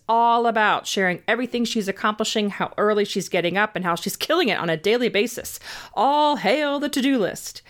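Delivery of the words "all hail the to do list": slow, grand mock salute; mock proclamation. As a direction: hail it like a herald, tongue in cheek. Mock salute to busy culture.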